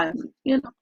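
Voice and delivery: ordinary talk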